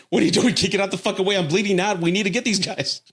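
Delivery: bleeding partner frantically urging him on; mock frantic partner.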